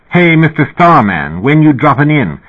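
high, lightly musical quote; mock performer. A narrator's reading. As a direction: lift the line like a bit of a show. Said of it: "They expected me to sing".

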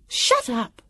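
high, sharp snap; irritated snap without shouting; snapped. A narrator's reading. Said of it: "Vivian snapped".

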